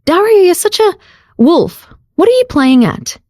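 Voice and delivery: squeaky mock outrage; playful scolding. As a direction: swat him with the words. Jade scolds him playfully.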